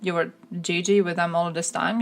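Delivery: normal talking voice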